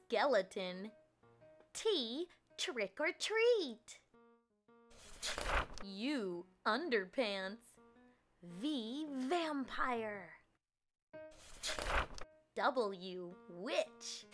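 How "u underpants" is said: ordinary talk, nothing marked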